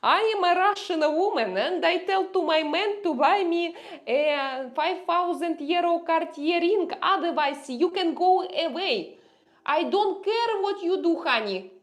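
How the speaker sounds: high, loud, haughty gold-digger voice; Russian woman TikTok voice. Retold or imitated. To her man, demanding a Cartier ring, high and loud haughty. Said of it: "Yes, you know those girls".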